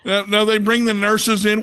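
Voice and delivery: ordinary delivery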